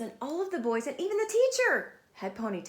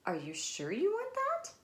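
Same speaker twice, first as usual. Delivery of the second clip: high, questioning mother; high doubtful question; doubtful mom; 10 semitones higher than usual. Acted. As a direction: ask with raised brows. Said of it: "said her mom".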